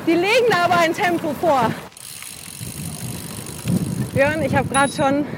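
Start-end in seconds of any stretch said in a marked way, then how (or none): 0.1-1.7 higher, projected so it carries; raised-voice repeat. Real, not acted. Rider repeats a remark to companion who asked "Was?", raised voice. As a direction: say it up and out for someone who missed it.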